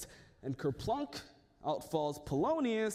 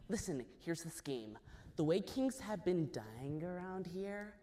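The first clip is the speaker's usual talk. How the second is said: conspiratorial; 2 semitones lower, 6 dB quieter than usual. Acted. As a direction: lean in and share the secret. Ophelia confides her scheme.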